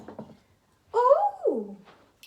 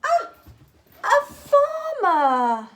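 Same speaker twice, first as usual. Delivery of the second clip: excited reveal; 6 semitones higher, 8 dB louder than usual. Real, not acted. To Tim, revealing the farmer from Bucket, loud and delighted. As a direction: unveil it like a treat.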